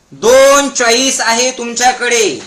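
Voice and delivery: slow, drawn-out proclamation; slow emphasis. Shown as a demonstration. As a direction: hammer the point home slowly. Hammers key point for crowd. (सावकाश, जोर देऊन)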